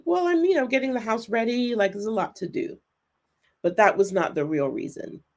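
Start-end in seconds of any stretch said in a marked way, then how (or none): 0.0-2.8 mock excuse. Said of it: "I gave a noble reason".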